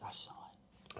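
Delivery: whispered mock testimony; hushed witness. Acting witness speaking up.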